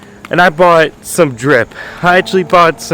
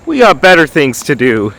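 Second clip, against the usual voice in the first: normal talking voice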